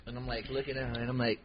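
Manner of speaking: ordinary delivery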